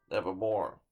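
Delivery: plain reading voice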